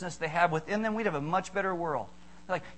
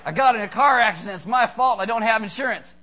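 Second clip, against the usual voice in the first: loud, raised-pitch hammed-up alarm; mock distress; 4 semitones higher, 9 dB louder than usual. Speaker mimics an uninsured driver after a crash, mock distress. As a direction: wail it out like a comic crisis.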